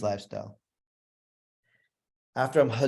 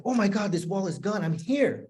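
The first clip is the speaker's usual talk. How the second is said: excited; 7 semitones higher than usual. Retold or imitated. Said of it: "I was so excited".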